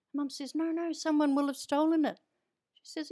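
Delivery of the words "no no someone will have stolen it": higher, louder Irish protest, sure it's gone; worried Irish accent. Retold or imitated. Mum insisting to her sister, in a higher, louder Irish accent. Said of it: "you went into an Irish accent there".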